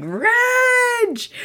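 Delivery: pounding on glass doors, screaming for her husband; desperate call. Pretend. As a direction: scream for him like she's pounding on the door. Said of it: "smack those glass doors and be like".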